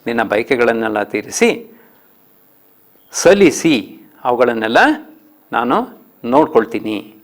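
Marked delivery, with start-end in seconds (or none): none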